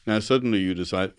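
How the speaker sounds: usual speaking voice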